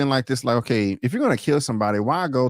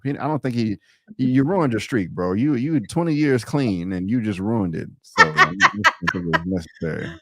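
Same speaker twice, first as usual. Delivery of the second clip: ordinary delivery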